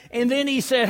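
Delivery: normal talking voice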